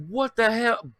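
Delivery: high, loud, startled what the hell; startled outcry. Retold or imitated. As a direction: yelp it out in shock. Sees something in the road.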